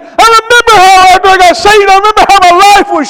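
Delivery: high, loud, building testimony; impassioned, hammering pulpit cadence; full-force preaching. Real, not acted. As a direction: proclaim it to the whole congregation.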